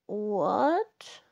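mild storybook bear growl. A narrator's reading. Woken Bear answers Ladybug, a mild growl. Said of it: "growled Bear".

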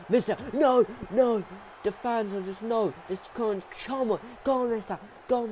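high, worked-up protest; high-pitched anxious cheering; urgent pleading. Real, not acted. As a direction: plead with your favourite to keep going. Pleading with losing wrestler.

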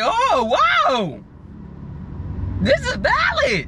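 slow, amazed praise; impressed. Retold or imitated. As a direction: act amazed at the movie. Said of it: "I was like".

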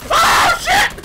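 very high, loud startled yell; alarmed shout. Real, not acted. Player caught out in game, alarmed shout. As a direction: shout it in sudden shock.

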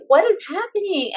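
high-pitched, baffled question; mock-bewildered outburst; retold bewilderment. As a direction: replay your own disbelief. Retelling her bafflement at exhaustion.

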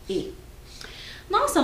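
ordinary delivery

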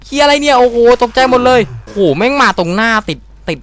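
loud, high jump-scare shouts; scared gamer cursing at the screen; startled yelling. Real, not acted. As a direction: jolt and curse at the scare. Enemy pops up in front.

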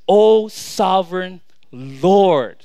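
loud, very slow, solemn invocation; humble reverence. Acted. Abram addressing God before his complaint, humbly reverent. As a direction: stretch each word out in awe. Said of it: "I love the humility".

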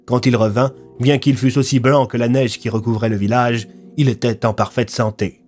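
ordinary delivery, nothing marked

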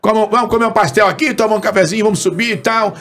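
high-pitched chatty radio host impression; Antônio Carlos. Radio host inviting the imitator to the studio, high-pitched impression. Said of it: "Antônio Carlos".

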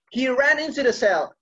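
ordinary delivery

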